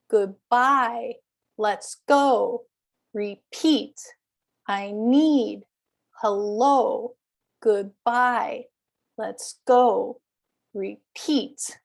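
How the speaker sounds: i NEED, hel-LO, good-BYE, let's GO, re-PEAT; short first syllable, stretched second in I need, Hello, Goodbye, Let's go, Repeat; second-syllable stress. Shown as a demonstration. Teacher modelling column three, I need to Repeat stressed on second syllable. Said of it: "then it goes to the stressed syllable".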